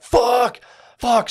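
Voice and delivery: high, loud mock scream; retold screaming fit. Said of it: "kicked and screamed his bed".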